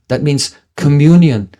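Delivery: normal talking voice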